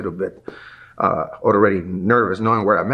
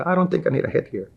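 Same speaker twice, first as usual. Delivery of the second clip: higher and fast, cocky refusal; cocky attitude; 8 semitones higher, faster than usual. Retold or imitated. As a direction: brush him off like a know-it-all. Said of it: "And I get an attitude".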